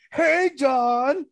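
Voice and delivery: high, loud sing-song call. Acted. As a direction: shout a teasing hello across the yard. Calling out to Don mockingly.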